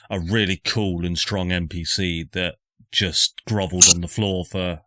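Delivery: ordinary talk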